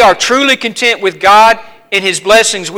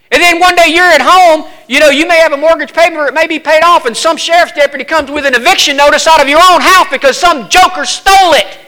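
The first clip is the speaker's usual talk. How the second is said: higher, louder fiery preaching; preaching at full force; 8 semitones higher, 4 dB louder than usual. Acted. Preacher warning the congregation about deed theft, fiery. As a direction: build the outrage for the congregation.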